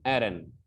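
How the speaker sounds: "आयरन" as short ae-run; changed pronunciation. Shown as a demonstration. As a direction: open "आयरन" on ae, not eye. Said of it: "ऐसे बोलना है".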